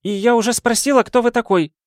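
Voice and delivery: high, dismissive woman's voice; an exasperated lady waving off his logic; lady's voice. Acted. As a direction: brush him off in a high affected tone. Lady demands an answer.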